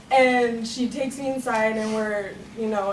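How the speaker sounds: ordinary delivery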